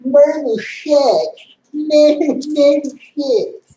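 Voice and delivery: high, slow whiny mock cry of the complainers. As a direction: drag it out high like a whining fan. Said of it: "people just bitching and moaning".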